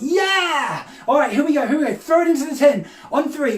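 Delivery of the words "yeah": high, drawn-out cheer; demonstrated shout. Shown as a demonstration. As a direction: call out to rouse the children.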